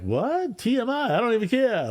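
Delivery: very high-pitched, put-on disbelief; hammed-up high reaction to oversharing; mock incredulous. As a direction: squeal it in exaggerated bafflement. Retold baffled reaction while driving away.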